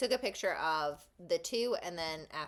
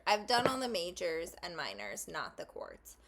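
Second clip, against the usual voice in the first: ordinary talk, nothing marked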